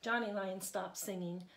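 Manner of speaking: plain reading voice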